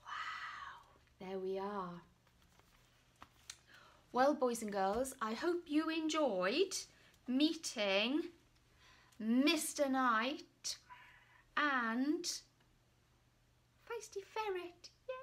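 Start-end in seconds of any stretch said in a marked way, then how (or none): none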